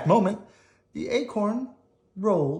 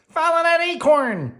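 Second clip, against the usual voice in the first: high, urgent little squirrel voice; excited squirrel; 12 semitones higher, 4 dB louder than usual. Acted. Squirrel shouting to the taxi driver, high and urgent. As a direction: bark it like a chase order.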